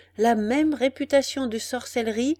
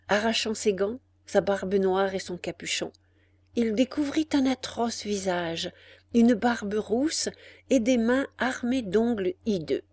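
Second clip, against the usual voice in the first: usual speaking voice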